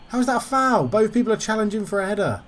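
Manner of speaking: high-pitched, incredulous complaint; indignant protest. Real, not acted. Gamer disputing a header foul with the ref, indignant. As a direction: argue the call in disbelief.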